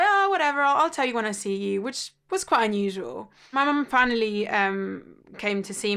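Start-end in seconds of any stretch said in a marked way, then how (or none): 0.0-1.8 breezy brush-off. Retold or imitated. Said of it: "I was like".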